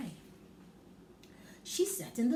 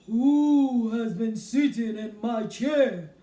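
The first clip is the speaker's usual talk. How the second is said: big booming slow bear voice, much louder; very loud Father Bear; 12 dB louder, slower than usual. Acted. Father Bear asks about porridge, booming loud slow. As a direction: boom it out slow like a huge bear. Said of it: "he asked in a very loud voice".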